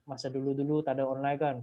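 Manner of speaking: ordinary delivery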